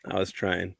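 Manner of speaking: ordinary delivery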